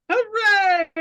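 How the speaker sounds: high loud hooray; cheer. Acted. Player celebrating the recruit, a high loud cheer.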